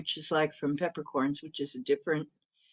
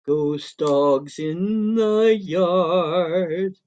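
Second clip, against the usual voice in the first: spooky voice; 4 dB louder, much slower than usual. Pretend. Reading chat spookily.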